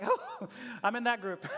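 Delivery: said through a laugh; laughing realisation. Acted. Congregant realising they are a loser, laughing. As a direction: laugh it out, caught being one of them.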